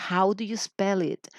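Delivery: slow, clear teacher question; careful model of the spelling question. Shown as a demonstration. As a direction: say it slowly so students learn it. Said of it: "así".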